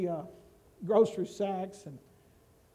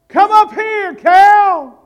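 much higher, loud ringing call; heavenly shout; 11 semitones higher, 16 dB louder, much slower than usual. Acted. God calling Cal up to heaven, loud ringing shout. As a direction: call him up from the sky at full voice. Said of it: "there'll be a shout".

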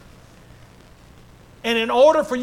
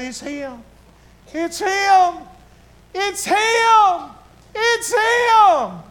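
rising shout; 10 semitones higher, 6 dB louder, much slower than usual. A narrator's reading. Believers see Jesus and cry out, building to an ecstatic shout. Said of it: "we'll begin to shout ourselves".

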